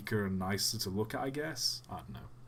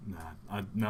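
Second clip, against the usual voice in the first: usual speaking voice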